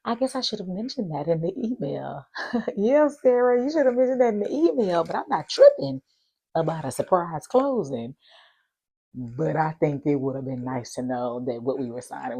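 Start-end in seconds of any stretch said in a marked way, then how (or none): 0.0-2.3 high, sugary apology; mock-sweet sorry from the agent; high put-on apology; apologetic agent. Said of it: "she said". 2.8-8.2 loud, high sassy comeback; louder high sarcastic yeah Sarah; sarcastic retort. Real, not acted.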